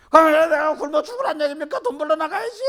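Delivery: loud, high, indignant protest of a hard-pressed father; indignant complaint. Retold or imitated. Preacher acts out a man protesting he must earn money, loud and indignant. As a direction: huff it out as a fed-up provider.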